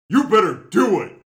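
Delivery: loud booming threat; giant's shout. Acted. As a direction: bellow it like a thirty-foot brute. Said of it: "To which Maul replies".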